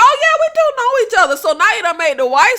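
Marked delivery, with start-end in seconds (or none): 0.0-1.4 quick, high, breezy mock cover-up; high, rushed imitation of Samantha playing it cool. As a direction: toss it off fast and high like nothing's wrong. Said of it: "and talk about".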